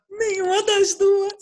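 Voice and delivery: put-on high, slow child's voice refusing both; cheeky kid voice. Child answering his mother, high and cheeky. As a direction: play the little boy saying neither, drawn out.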